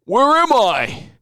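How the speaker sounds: loud, high mock bewilderment; dazed co-host impression. Mocking co-host walking in disoriented, loud high wail. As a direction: wail it slowly, hammed-up confusion. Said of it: "you just r-, walk in the place like".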